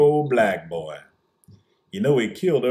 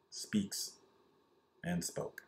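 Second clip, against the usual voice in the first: whispered aside; 5 semitones lower, 13 dB quieter, much slower than usual. Real, not acted.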